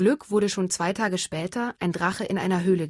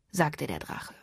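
plain reading voice

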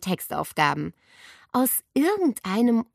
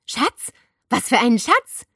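higher, mild surprised outburst; startled cry; 5 semitones higher, 7 dB louder than usual. A narrator's reading. Tanne startled, asking friends about the treasure. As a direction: catch on the word Schatz. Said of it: "Tanne stieß einen Schrei aus".

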